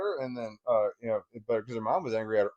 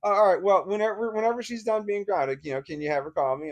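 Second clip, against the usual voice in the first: high, cheery imitation of the ex; mock-pleased, singsong acceptance; suddenly happy; 7 semitones higher than usual. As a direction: turn breezy and agreeable, mimicking her. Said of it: "like, happy".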